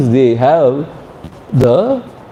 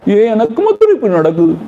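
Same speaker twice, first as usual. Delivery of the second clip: fast, higher mock complaint of self-pity; 7 semitones higher, much faster than usual. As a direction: whine quickly, as if only you suffer. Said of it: "sympathize means".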